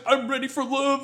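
high-pitched mimicry of a hopeful contestant; mock lovestruck contestant. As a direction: put it up high, mocking her eagerness.

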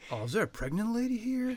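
high, laid-back surfer voice; surfer dude. Pretend. A beach surfer spots a pregnant woman from the boat.

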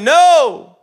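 loud, high drawn-out no; shouted answer. Acted. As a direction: holler it back across the water. Acted answer called back from boat.

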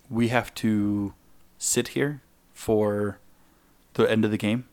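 very slow, drawn out disbelief; incredulous. Retold or imitated. Told no shuttles until game end, slow incredulous. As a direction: stretch each word in disbelief.

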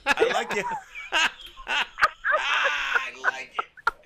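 laughing high and loud; bursting into laughter on the words; cracking up mid-line. Real, not acted.

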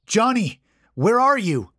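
high, loud searching call. A narrator's reading. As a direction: shout for a missing friend, pitched well up. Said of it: "Lucas called out himself".

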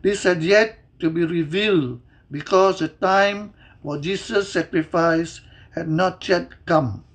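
normal talking voice

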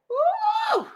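sudden high woo shout; high whoop. Real, not acted. Reacting to the book with a high woo. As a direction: whoop in disbelief.

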